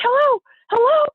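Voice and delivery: high, loud imitation of an anxious phone greeting; high-pitched insistent greeting; wife on phone. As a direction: mimic an urgent wife grabbing the call.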